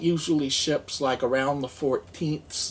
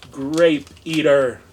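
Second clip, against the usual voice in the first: loud announcer read; 8 dB louder than usual. Pretend. Reads label in put-on voice.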